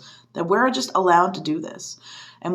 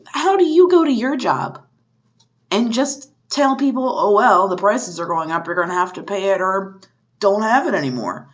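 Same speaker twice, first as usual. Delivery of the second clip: exasperated shouting; 3 semitones higher, 3 dB louder than usual. Real, not acted. Outraged rant peaks.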